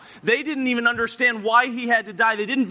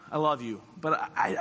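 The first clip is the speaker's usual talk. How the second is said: low, soft, faltering admission; subdued and unsure; hesitant confession; 5 semitones lower, 7 dB quieter than usual. Acted. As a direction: act out love mixed with self-doubt. Voicing Peter's hesitant answer to Jesus.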